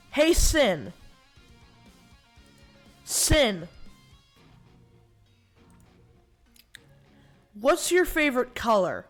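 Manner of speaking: loud wake word call to a device. Real, not acted. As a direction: project it so the mic hears. Calling out to the AI assistant.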